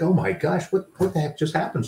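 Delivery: high, stunned disbelief; amazed gasp. Retold or imitated. Remembering the lightning moment, said high and amazed. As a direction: recall the jolt of sudden relief.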